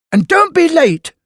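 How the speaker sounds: high, loud shouted order; bossy shout down the phone. A narrator's reading. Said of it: "she shouted over the phone".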